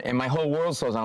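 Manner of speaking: normal talking voice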